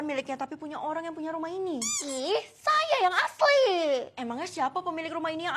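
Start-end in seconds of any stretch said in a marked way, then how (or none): 2.0-3.6 mock high squeaky claim. Claims to be the real owner.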